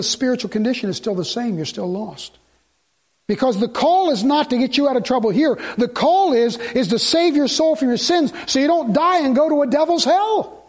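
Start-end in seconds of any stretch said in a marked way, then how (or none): 3.3-10.7 rising, loud preaching peak; fervent preaching. Real, not acted. Preacher warning the congregation, loud and fervent. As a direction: drive the point home to the church.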